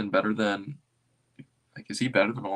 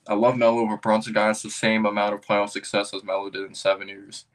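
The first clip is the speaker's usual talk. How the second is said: louder fired-up hot take; 5 dB louder than usual. Real, not acted. Streamer loudly reacting to a Melo take. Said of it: "Rest in peace, headphone users".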